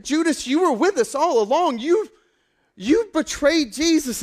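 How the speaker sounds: loud, high cry of betrayal; shocked accusation. Acted. Acted accusation of the betrayer Judas.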